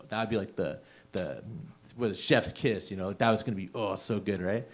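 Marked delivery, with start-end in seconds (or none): none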